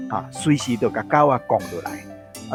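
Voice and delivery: ordinary talk, nothing marked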